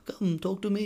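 put-on high-pitched beckoning old man; hammed-up little man calling someone over. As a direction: coax in a small, high put-on voice. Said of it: "And he's like".

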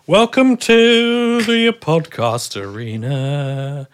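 slow, loud arena announcer; grand announcer. Pretend. Host welcoming listeners to the arena, slow and booming. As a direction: boom it out like a ring announcer.

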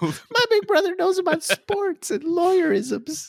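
put-on very high, slow childlike awe; mock little brother. Teasing his co-host about seeming cool, a very high slow kid-brother voice. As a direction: gush like an adoring kid brother.

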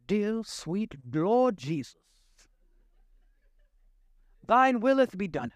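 slow, solemn, hammed-up holy prayer voice; a hint of a pious King James prayer; mock King James prayer voice. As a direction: pray like an old church elder, lightly mocking. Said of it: "pray their entire prayer in King James English".

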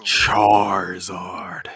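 hammed-up Southern, very drawn out; Southern accent. Host telling co-host how to say Charizard, mock Southern. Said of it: "say that like you Southern".

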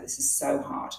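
breathy whispered despair, dropped right down. Retold or imitated. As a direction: sigh it out, worn out by the effort. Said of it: "because I was like".

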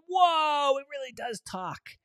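high, wowed exclamation; amazed. Acted. Sister hears the bed talk.

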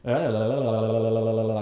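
mock someone who never stops talking; endless babble. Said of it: "the nerd tech dude goes".

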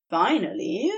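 usual speaking voice

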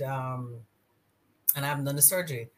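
normal talking voice

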